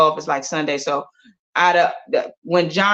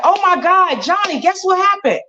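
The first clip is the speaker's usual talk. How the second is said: excited; 10 semitones higher than usual. Acted. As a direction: gasp it out with joy.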